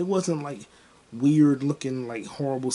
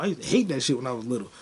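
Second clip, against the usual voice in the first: ordinary talk, nothing marked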